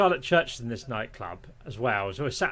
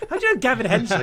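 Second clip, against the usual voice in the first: incredulous friends; 9 semitones higher, 7 dB louder, faster than usual. Retold or imitated. Friends' voice messages, quoted.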